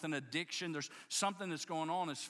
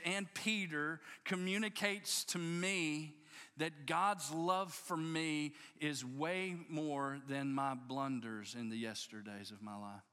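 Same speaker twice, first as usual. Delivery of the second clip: usual speaking voice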